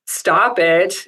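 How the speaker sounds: usual speaking voice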